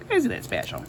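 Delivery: high prim sarcastic voice; mock prim voice. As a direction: squeak it out primly. Church Lady style quip.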